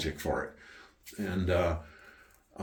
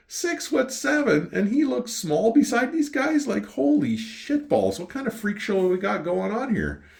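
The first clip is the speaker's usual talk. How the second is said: astonished; 14 semitones higher, 4 dB louder than usual. Retold or imitated. Retelling shock at the little guy's height, astonished. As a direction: exclaim it high-pitched and amazed.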